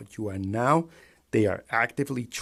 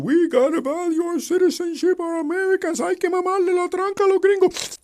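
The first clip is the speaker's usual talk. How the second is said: much higher, louder sneering mock voice; mocking fringe voter; 7 dB louder than usual. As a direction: parody them in a shrill mocking pitch. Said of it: "the fucking crazy fringe group that says".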